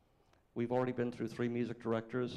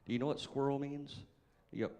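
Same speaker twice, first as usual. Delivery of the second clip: ordinary talk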